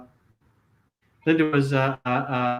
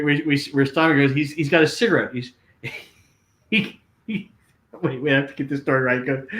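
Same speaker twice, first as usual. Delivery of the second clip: ordinary delivery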